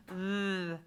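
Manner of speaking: TH held with vocal vibration; voiced th. Shown as a demonstration. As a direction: buzz "th" with tongue out. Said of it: "that voiced th".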